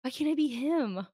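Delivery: loud, high whine of envy; mock jealous groan; envious fan. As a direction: wail at your own inadequacy. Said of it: "and you're like".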